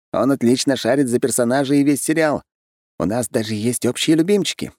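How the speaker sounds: lightly voiced keen fan; enthusiastic groom. Groom telling friends about shared hobby, mildly keen high voice. Fanerov praises new friend.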